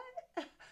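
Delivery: real laugh breaking out; high burst of laughter. Real, not acted. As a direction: let a giggle escape up high. Amused at own reaction.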